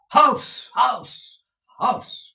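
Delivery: high, loud shouting from the stairs; loud cry. A narrator's reading. Folair crying out to Nicholas, loud and high. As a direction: bellow it up to the door. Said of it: "cried Mister Folair".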